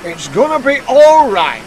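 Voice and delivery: loud, high, drawn-out announcement; reassuring manager. A narrator's reading. Manager telling the engines the news, loudly reassuring. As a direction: proclaim the good news slowly.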